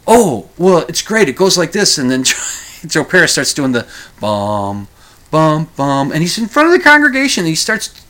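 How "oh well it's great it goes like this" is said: high, louder eager voice; mock keen Joe to the priest. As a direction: lean in keen to share.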